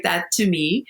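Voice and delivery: ordinary delivery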